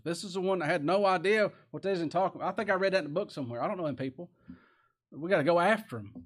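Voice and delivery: usual speaking voice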